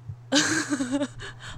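awkward high giggle; nervous laugh. Real, not acted. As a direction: laugh it off uncomfortably. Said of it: "好尴尬哦".

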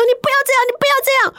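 very high, pleading voice; imitating the wife pleading with her husband. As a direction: beg him to stop, high and thin. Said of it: "是那个老婆说".

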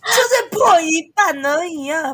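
slow, high half-cracked voice; voice cracking. Shown as a demonstration. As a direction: let the voice crack halfway. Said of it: "我刚刚破的没有很漂亮欸，就是半破".

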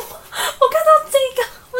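host reacting to the wife's text, laughing in exasperation; exasperated laugh-cry. Real, not acted. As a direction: laugh as if it physically pains you. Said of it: "我觉得头好痛哦".

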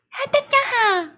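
put-on high, sing-song girly voice. Shown as a demonstration. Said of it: "你脑中的gay掰声音是什么".

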